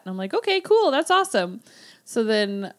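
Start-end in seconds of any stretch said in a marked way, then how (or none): 0.3-1.6 high, bright-eyed okay cool; eager reply. Retold or imitated. Thrilled student accepting a free hat, high and eager.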